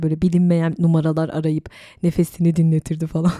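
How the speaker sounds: ordinary delivery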